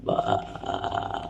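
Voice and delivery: low creaky fry; deep creaking fry; vocal fry. Shown as a demonstration. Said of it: "you've got vocal fry".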